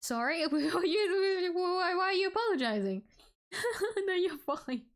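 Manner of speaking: giggling high through the words; laughing. Real, not acted. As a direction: laugh it out, amused. Laughing while answering chat.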